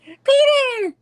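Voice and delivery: high, loud mock scream; acted alarmed cry. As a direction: cry out like a comic-book damsel. Acts out MJ's alarmed cry.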